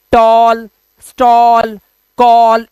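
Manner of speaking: टॉल, स्टॉल, कॉल loud, each with a held 'aw'; long rounded 'aw' in टॉल, स्टॉल, कॉल; repeat-after-me. Shown as a demonstration. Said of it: "मेरे साथ बोलो".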